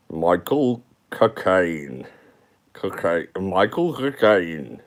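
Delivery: put-on slow, drawn-out Caine-style accent; Michael Caine doing an accent. Streamer reading a chat joke in a slow Caine accent. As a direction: stretch the name like Caine fumbling an accent. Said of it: "Michael Caine trying to do an accent".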